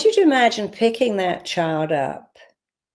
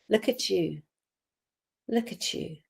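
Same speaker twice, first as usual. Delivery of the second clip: tender, soft; 8 dB quieter than usual. Shown as a demonstration. Parent voice to inner child.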